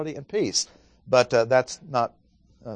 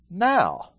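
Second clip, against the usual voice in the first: now raised high and set apart; emphasis on now; 7 semitones higher than usual. Shown as a demonstration. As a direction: lean hard on the word now. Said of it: "you might put a little emphasis on that".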